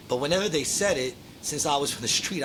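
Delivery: ordinary talk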